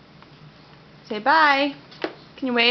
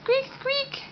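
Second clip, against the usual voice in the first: much higher, softer squeak squeak; mouse squeak; 12 semitones higher, 7 dB quieter than usual. Shown as a demonstration. Mother showing her toddler a mouse, high and soft. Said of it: "What does the mouse say?".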